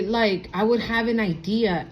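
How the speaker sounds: ordinary delivery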